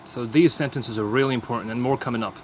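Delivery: usual speaking voice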